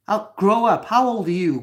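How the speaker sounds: sharp, loud and high rebuke; furious grow up; angry shout. Real, not acted. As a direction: snap it at the troll, loud. Angry reply to a troll.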